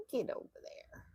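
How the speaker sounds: soft unvoiced coaxing; whispered to herself. Real, not acted. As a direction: whisper it to the thread. Coaxing thread under breath.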